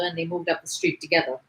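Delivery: ordinary delivery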